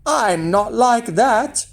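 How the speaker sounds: put-on drawn-out boyish persuasion; coaxing. A narrator's reading. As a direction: wheedle it slowly as a boy. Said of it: "coaxed Jack".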